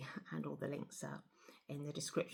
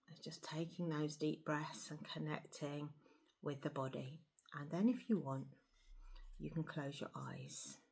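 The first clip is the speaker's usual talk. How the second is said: soft, hushed meditation voice with long pauses; guided meditation; 13 semitones higher, 10 dB quieter than usual. Acted. Guide leading listeners to feel the breath and body, guided meditation. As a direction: settle them gently into stillness, softly.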